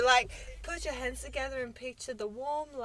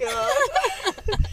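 mock dismayed astronaut; 11 semitones higher, 5 dB louder than usual. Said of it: "But she was like".

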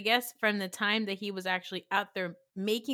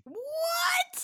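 very high drawn-out squeal; high, indignant outburst; shocked disbelief. Real, not acted. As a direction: squeal in real disbelief at the opinion. Disagrees about the cute kid.

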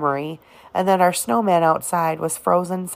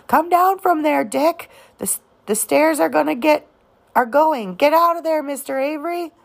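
men shouting; 9 semitones higher than usual. A narrator's reading. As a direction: shout a warning up at him. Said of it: "men shouted".

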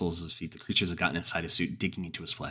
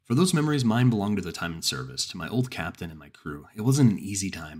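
plain reading voice

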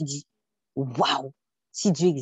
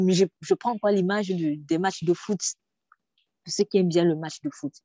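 usual speaking voice

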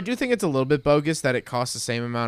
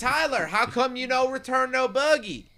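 bad fake Southern accent, high pitched; 6 semitones higher, 4 dB louder than usual. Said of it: "That was a really bad Southern accent".

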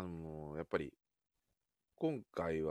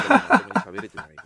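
laughing loudly, high-pitched; words spilling out through a laugh; 13 semitones higher, 20 dB louder, faster than usual. Real, not acted. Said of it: "まともに喉がもう".

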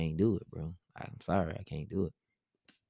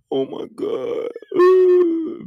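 breathy gasp then a high squeal, hammed up; mock dramatic; 8 dB louder, much slower than usual. Mocking a dramatic partner at the birth, hammed-up squeal. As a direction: overplay the shock for laughs. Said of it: "be all dramatic and shit".

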